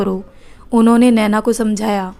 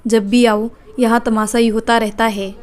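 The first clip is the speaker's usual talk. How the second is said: ordinary delivery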